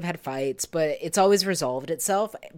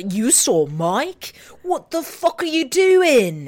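higher, louder, slow indignant yell; angry outburst; 8 semitones higher, 4 dB louder, slower than usual. Retold or imitated. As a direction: explode at her in disbelief. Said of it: "Ian comes in with a".

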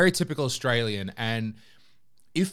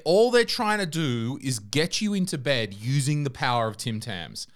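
ordinary delivery

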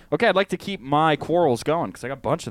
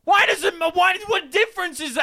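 exasperated outburst. Real, not acted. Friend exasperated at being corrected, high outburst. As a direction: throw up your hands at the nitpick. Exasperated over pronunciation quarrel.

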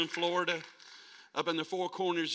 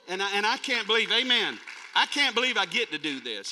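louder, higher, emphatic testimony; excited exclamation; 6 semitones higher, 7 dB louder than usual. Real, not acted. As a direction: exclaim it to the whole congregation. Preacher exclaims about his calling.

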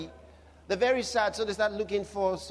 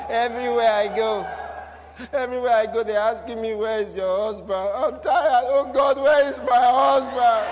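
hammed-up wail building louder and higher; mock desperate lament; 4 semitones higher, 5 dB louder than usual. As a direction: plead to God in exaggerated despair, drawing out the end. Acts out unmarried woman's desperate complaint.

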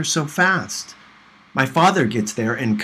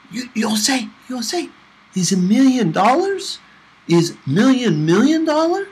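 put-on high, excited boy's voice, getting louder; a kid badgering, voice climbing high; little Pablo piping up louder and louder, high and eager; pestering little boy; 11 semitones higher, 5 dB louder than usual. Little boy pipes up guessing.

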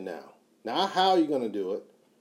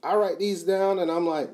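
ordinary delivery

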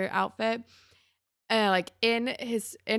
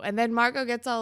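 ordinary delivery

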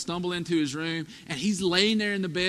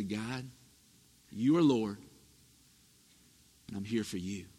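soft, low morning prayer; quiet prayer; 10 semitones lower, 5 dB quieter than usual. Shown as a demonstration. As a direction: say it softly, like a whispered vow. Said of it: "you say".

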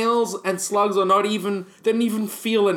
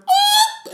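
shrill high scream of fright; 7 dB louder than usual. Retold or imitated. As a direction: scream like a startled kid. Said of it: "'cause I just screamed and went".

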